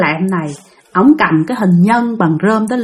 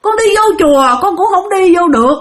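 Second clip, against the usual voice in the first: high, plaintive begging; pleading; 8 semitones higher than usual. Retold or imitated. Spirit begging the master to let her stay home, pleading. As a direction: act out a pitiful, homeless spirit's plea. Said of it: "Bắt đầu van xin".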